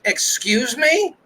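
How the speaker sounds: high, drawn-out mock offence; imitated indignant mom. Mother turning around on a critic, imitated indignant mom. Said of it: "she's gonna turn around and be like".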